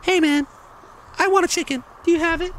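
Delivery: rude customer voice. Acted. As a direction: squeak out the order like a pushy customer. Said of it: "not if you ask me like that".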